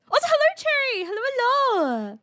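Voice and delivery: high, loud cheerful hellos; bright high greeting. Real, not acted. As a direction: sing out a hello to a viewer. Greets a chat member.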